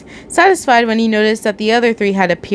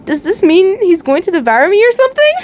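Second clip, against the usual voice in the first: alarmed; 10 semitones higher, 4 dB louder than usual. Acted. Scared reader asks about devouring.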